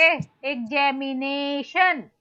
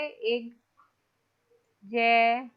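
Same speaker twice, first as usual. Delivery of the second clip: "एक जय मी ने शन" said as separate syllables with pauses between; 3 semitones lower, 3 dB quieter, much slower than usual. Shown as a demonstration. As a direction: break the word into its five syllables, one by one. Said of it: "कैसे stress और unstress mark करोगे".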